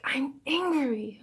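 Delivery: high, drawn-out mock cry of anger; slow, high complaint, a mild mock scream; retold child screaming. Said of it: "I saw her screaming".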